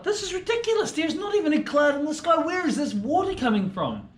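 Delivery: high, exasperated little bird voice; indignant swallow. Acted. Swallow fed up with mystery drips, high indignant squeak.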